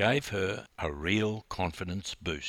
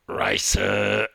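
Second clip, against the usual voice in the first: a short, gruff, louder grunt of one word; 6 dB louder than usual. Acted. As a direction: grunt it like a terse coach. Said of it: "grunted Big Bill".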